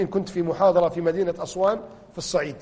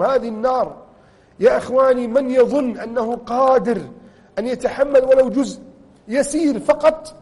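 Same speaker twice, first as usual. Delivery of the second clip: usual speaking voice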